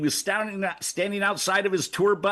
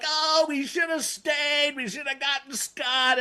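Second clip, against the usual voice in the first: mock regret; 7 semitones higher, slower than usual. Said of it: "And then I'm like".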